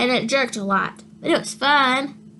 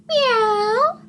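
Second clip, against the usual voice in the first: high, drawn-out meow; cat meow; 10 semitones higher than usual. Acted. Cat on the tractor, high mock meow. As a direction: purr out a lazy meow. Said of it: "said Cat".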